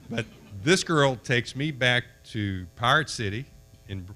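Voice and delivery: ordinary delivery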